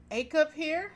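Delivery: high excited question; eager little girl gasp. Acted. Excited child asks.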